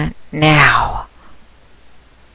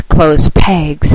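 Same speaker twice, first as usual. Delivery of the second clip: ordinary delivery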